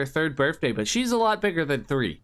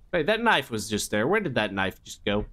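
usual speaking voice

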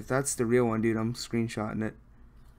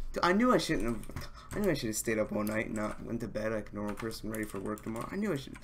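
ordinary delivery

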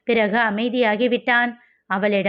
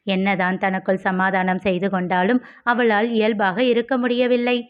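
plain reading voice

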